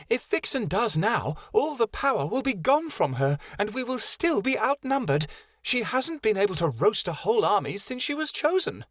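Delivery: high, small fairy voice speaking of herself; a tiny high fairy, hissing; fairy Vixen voice. Acted.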